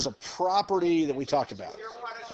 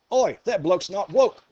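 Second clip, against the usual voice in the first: put-on English accent; 5 semitones higher, 4 dB louder than usual. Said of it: "do my impression anyways".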